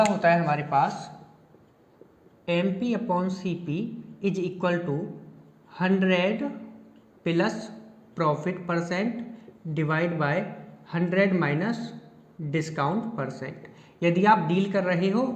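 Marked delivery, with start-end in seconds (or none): 2.5-13.7 slow dictation. Shown as a demonstration. Dictating formula slowly to class.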